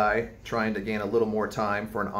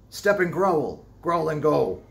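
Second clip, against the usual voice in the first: barked command; 7 semitones higher, 5 dB louder, slower than usual. A narrator's reading. Quoting captain's orders.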